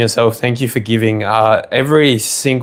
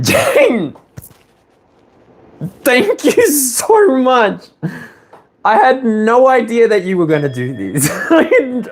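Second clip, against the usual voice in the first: overjoyed thanks; 12 semitones higher than usual. Real, not acted. Thanks surprise donor through laughter.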